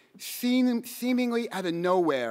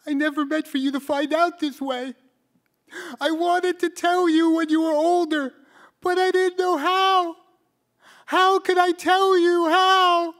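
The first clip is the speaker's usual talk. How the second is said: high, strained whine, slowing as it builds louder; pleading whine; 11 semitones higher, 6 dB louder than usual. Retold or imitated. Father to his son in the car, tearful pleading whine. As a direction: hold back tears while begging forgiveness. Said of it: "his voice became this pleading whine".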